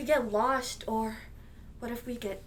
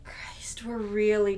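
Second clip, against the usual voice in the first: whispering; slower than usual. Acted. Nervous realization of the protest.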